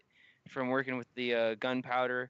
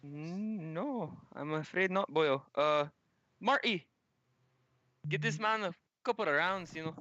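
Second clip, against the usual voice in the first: higher, lilting Irish voice; Irish gunsmith; 3 semitones higher than usual. Acted. Gunsmith calls his helper, higher Irish voice. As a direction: genial Irish shopkeeper's brogue.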